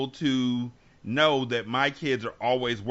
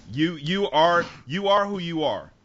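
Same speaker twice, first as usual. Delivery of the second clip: usual speaking voice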